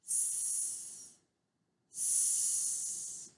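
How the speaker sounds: S as a long unvoiced hiss; snake hiss sound. Shown as a demonstration. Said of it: "You say this one with me too?".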